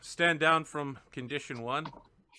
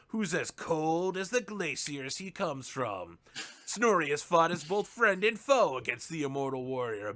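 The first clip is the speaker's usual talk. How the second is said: movie trailer voiceover. Acted. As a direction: sell it like a blockbuster trailer. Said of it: "there's a voiceover that's like".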